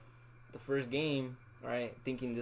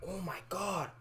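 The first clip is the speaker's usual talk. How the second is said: higher-pitched mock scolding of a friend; imitated disappointment; 6 semitones higher than usual. Friend scolds Edgar over the eighth grader, in mock disappointment. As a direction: imitate someone appalled and let down, pitched up. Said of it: "he was so disappointed".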